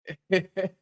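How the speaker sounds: brief wordless chuckle; quick amused chuckle; short chuckle. Real, not acted. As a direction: let out a short laugh. Laughing at the live chat.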